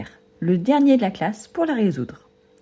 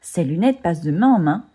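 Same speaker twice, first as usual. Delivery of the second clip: usual speaking voice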